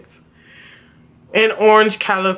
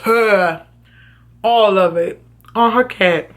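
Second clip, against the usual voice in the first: ordinary delivery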